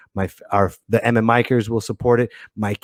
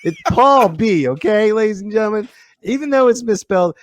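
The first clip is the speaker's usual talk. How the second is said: yelling; 8 semitones higher, 3 dB louder than usual. Real, not acted. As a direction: shout the name at the viewers. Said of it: "I'm yelling at the, at the viewers".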